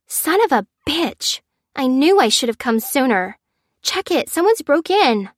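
high, agitated outburst; alarmed young woman. A narrator's reading. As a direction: exclaim in alarm at a break-in. Stranger outside finds a break-in.

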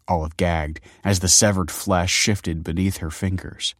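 ordinary delivery

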